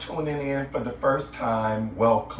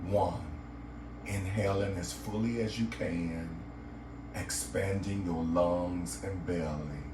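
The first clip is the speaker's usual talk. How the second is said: guided meditation; 5 semitones lower, 8 dB quieter than usual. Acted. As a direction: guide the listener gently into relaxation, lower and softer than a greeting.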